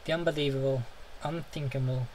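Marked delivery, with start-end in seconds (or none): none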